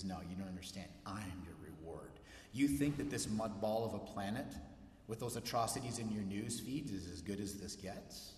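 low, soft reassuring voice; gentle voice of God. Pretend. God reeling doubters back with soft reassurance. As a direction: draw them back in, softly.

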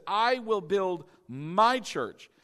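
"I" and "my" both drawn out and stressed; slow down, land hard on "I", then on "my"; emphasized. Retold or imitated. Preacher answering whose church it is, slow stress on "I" and "my". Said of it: "And I have emphasized this".

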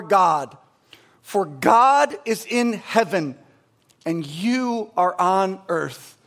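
slow, solemn reading of the verse; scripture reading. Acted. Pastor reading Ecclesiastes to the congregation, slow and solemn. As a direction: read each line slowly and deliberately.

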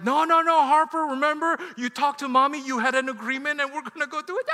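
loud, high panicked insistence; frantic pleading. Retold or imitated. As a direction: plead loudly with a reluctant child. Acted father pleading with reluctant daughter.

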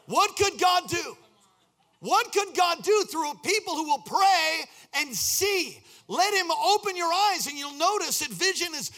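high, loud preaching climax; a pastor at full force, high and loud; fiery preaching. Acted. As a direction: preach it to the rafters.

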